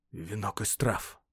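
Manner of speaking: slow, soft exclamation of wonder; hushed astonishment. A narrator's reading. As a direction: draw it out softly in surprise. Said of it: "воскликнул он удивлённо". (тихое, медленное удивление)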